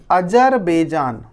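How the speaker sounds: Azerbaijan stretched out slowly; slow drawn-out name. Shown as a demonstration. Teacher to pupils, slowly naming a country on the map. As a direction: give Azerbaijan slowly, syllable by syllable. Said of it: "teacher names country slowly".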